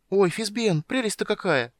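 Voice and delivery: high, delighted kender voice; high-pitched, thrilled exclamation; excited calling out. A narrator's reading. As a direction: gush with wonder, then eagerly beg. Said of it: "крикнул Тас через плечо".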